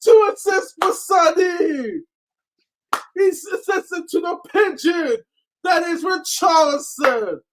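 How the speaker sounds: high excited shouts; excited shouting. Real, not acted. Co-host still buzzing after the goal, high excited shouting. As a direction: keep the buzz going.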